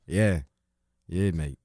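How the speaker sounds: put-on Australian accent. Mock Australian.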